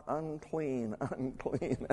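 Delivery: softly imitated leper's call; understated calling out. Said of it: "comes up and calls out".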